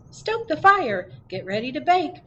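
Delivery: high, loud squeaky little mouse call. A narrator's reading. Storyteller voicing Mouse calling the friends to bake, high and squeaky. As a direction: squeak it out like an excited tiny mouse. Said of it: "Mouse squeaks".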